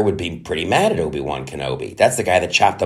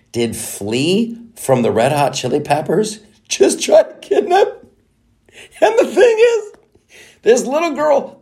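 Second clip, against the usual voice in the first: incredulous; 13 semitones higher than usual. Real, not acted. Ranting to viewers about the kidnap, incredulous high voice. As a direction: let disbelief soar.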